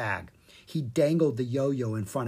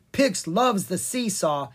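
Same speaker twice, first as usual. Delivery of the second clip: cried out; 6 semitones higher, 3 dB louder than usual. Acted. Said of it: "he cried".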